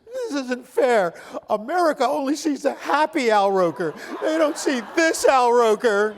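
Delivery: mock-wail the daughter's complaint; lightly voiced tearful daughter. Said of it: "She starts crying".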